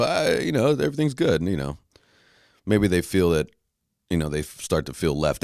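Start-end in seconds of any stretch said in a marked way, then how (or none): 0.0-1.4 mock breezy "everything's good", much higher; fake cheer. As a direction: brush it off with bright fake cheer. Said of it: "Because when I go".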